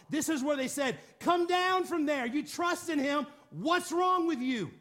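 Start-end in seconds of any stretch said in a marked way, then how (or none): 1.2-4.7 acted crowd mockery, pitched up; mocking scoff. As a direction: scoff at them, slowing to drawn-out final words. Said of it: "this is where the, the people mocked. This is where they scoffed".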